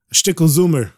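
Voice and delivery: little bit said as shtickle, summer as zoomer with a Z; Yiddish accent. Shown as a demonstration.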